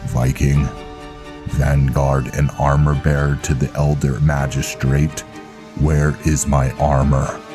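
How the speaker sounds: low, projected formal address; stern knight commander. Acted. Velen demanding his armor from Viking, stern. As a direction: call on the armor bearer in a low voice.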